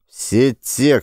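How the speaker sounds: ordinary delivery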